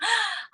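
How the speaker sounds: sharp, high intake of breath; re-enacted gasp of shock; startled gasp. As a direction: gasp as if suddenly taken aback. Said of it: "aku tersontak gitu ya".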